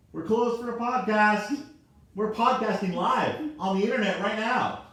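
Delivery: high, carrying call across the room. Real, not acted. Host calls to someone interrupting the live podcast, calling out.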